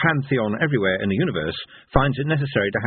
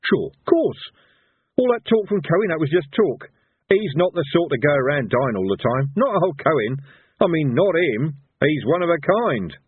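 higher-pitched, insistent denial; touch of desperation; 7 semitones higher than usual. A narrator's reading. Villain insisting his old friend survived, a bit desperate. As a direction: talk yourself into believing it. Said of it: "slight touch of desperation in Harry's raddled face".

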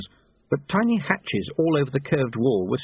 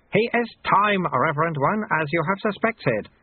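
higher-pitched character voice; raised-pitch old monk; old sweeper Lutze; 7 semitones higher than usual. A narrator's reading. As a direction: address the abbot as a deferential sweeper.

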